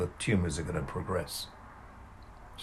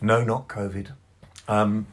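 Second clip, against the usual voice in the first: ordinary delivery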